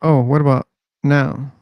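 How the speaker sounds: ordinary delivery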